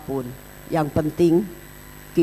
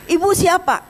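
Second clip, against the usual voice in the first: a sharp, much higher challenge; demanding protester; 13 semitones higher, 5 dB louder than usual. Retold or imitated. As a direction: snap the question at her.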